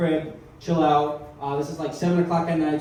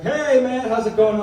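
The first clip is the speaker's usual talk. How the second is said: higher, easygoing phone greeting; calm, cheery friend; 6 semitones higher, 5 dB louder than usual. Retold or imitated. Friend picking up the phone, calm and happy. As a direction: greet a buddy with breezy cheer. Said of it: "he's all calm and cool".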